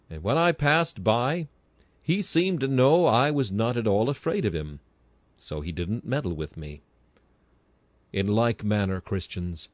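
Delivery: ordinary talk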